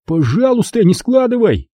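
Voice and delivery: high anxious plea; pleading Max. A narrator's reading. Max begging Rob not to fold the comic, anxious plea. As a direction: beg him not to bend it.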